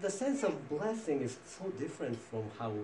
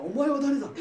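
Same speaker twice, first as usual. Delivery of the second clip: higher, louder, startled question; startled old father; 12 semitones higher, 9 dB louder, faster than usual. Acted. Blind father startled by a second son, higher and louder shocked question. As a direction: blurt it out in shock.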